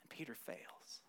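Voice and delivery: hushed whisper of failure. Real, not acted. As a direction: drop to a whisper. Sad climax of the sermon.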